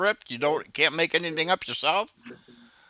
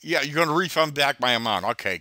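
normal talking voice

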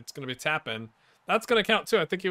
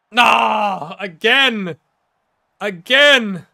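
higher and louder, clipped; exasperated shout; 7 semitones higher, 12 dB louder, much slower than usual. Real, not acted. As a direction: vent at the same mistake. Frustrated at repeated error.

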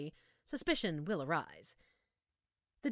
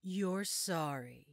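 normal talking voice

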